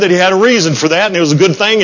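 ordinary talk, nothing marked

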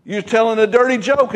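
usual speaking voice

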